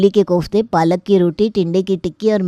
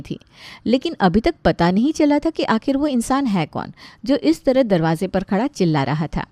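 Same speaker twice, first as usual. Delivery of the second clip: softer, playful storyteller voice; chatty comic narration, quieter than the characters; story narration; 3 dB quieter than usual. Acted. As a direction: narrate the family comedy to the audience.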